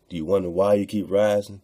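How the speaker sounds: normal talking voice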